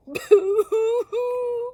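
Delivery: very loud, high hammed-up wail; all the friends bawling; group sob. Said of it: "cried Bird's friends together".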